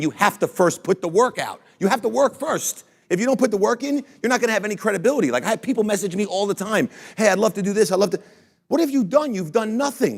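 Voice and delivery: high, fired-up lecture on putting the work in; riled-up speaker scolding lazy dreamers; fired-up rant. Real, not acted. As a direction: push it hard, pitch climbing as you preach. Passionate rant on credibility.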